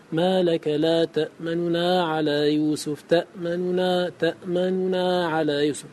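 تأمننا said slowly with the first nun's vowel half-voiced; slow recitation showing ikhfa. Shown as a demonstration. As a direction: stretch تأمننا so the hidden vowel is just audible. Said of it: "يسمع في الإدغام".

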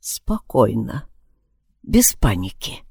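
soft, slow self-calming. Acted. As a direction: talk yourself down quietly. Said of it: "попытался выровнять дыхание".

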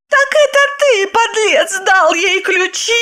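very high, enraged accusation; fierce. A narrator's reading. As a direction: round on the husband in a high shout. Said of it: "свирепо воззрилась на мужчину". (свирепо, высоким голосом)

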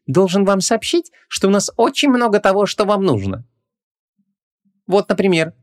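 high-pitched, insinuating salesman; confiding shopkeeper. A narrator's reading. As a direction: pitch the goods to a customer. Oily shopkeeper pitching his goods.

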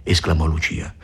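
ordinary delivery, nothing marked

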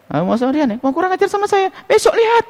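high, hushed mock indignation; mock threat. Preacher imitates offended kiai warning of revenge, mock threat.

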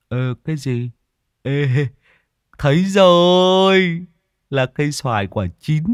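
hungry lad calling out; greedy Tý voice. Acted. Tý spots the mango.

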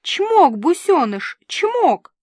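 high, loud, straining coaxing; надрывные уговоры. A narrator's reading. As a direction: urge the pet on with high, punched kissy calls. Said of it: "надрывалась Дуся". (надрывается, громко и высоко)

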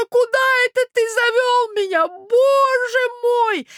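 very high, drawn-out lament; old prince, appalled. Acted. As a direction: wail to be taken away from here. (старый князь, в ужасе, высоко причитает)

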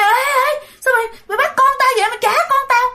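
high-pitched, rushed demand; frantic angry mother. Acted. Mother chases the woman who took her baby, frantic anger. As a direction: cry out high as you give chase.

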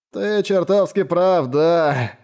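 put-on drunk, slow slurred words; slurred speech. A narrator's reading. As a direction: slur it like a drunk. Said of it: "заплетающимся языком сказал Энбо".

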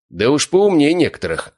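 a touch of a snappy retort; snapping back. Acted. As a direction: snap it back, mildly irritated. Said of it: "огрызнулся он".

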